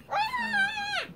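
sudden high shriek; high scream. Acted. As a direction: yelp in pain. Second scream.

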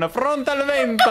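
champion battle cry. Retold or imitated. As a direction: boom it out like a hero's entrance. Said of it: "voleva annunciare il suo arrivo con".

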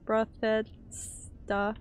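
very slow "Bruh, that's duh", piece by piece; laboured pronunciation attempt. Real, not acted. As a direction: stretch each chunk of "Bruh, that's duh" with gaps between. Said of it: "It's not working".

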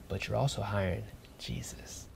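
hushed whisper, as if sharing a secret; whispered aside. Acted. Applicant whispering to the manager about hiring Jesus. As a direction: let it slip out in a whisper.

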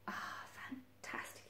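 slow, whispered sigh of pleasure; blissfully sighed, almost voiceless; contented sigh. A narrator's reading. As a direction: breathe it out very softly. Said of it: "he sighed".

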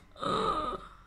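sharp high mock gasp. Mock outrage at crazy ideas.

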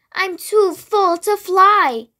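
wailing. A narrator's reading. Too-full greedy bee to the fireflies, slow mild wail. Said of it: "wailed the greedy bee".

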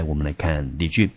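ordinary talk

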